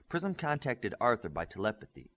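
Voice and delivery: usual speaking voice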